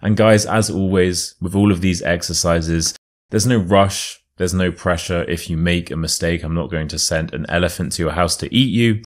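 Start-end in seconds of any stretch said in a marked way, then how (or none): none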